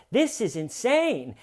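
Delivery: high, drawn-out mock outrage; enthusiastic outcry. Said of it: "the enthusiasm that the first one has".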